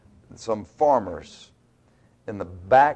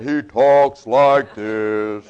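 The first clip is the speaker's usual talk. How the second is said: slow, louder mock pulpit intonation; preacher pulpit voice; 6 dB louder, much slower than usual. Shown as a demonstration. Mocking a robed preacher's pulpit voice. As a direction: ham up the holy-sounding pulpit voice. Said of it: "he talks like I'm talking right now".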